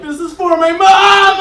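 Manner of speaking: high, loud, slow mock war cry; triumphant shout. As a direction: yell it like a battle cry. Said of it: "I'm like".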